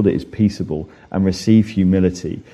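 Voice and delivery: ordinary delivery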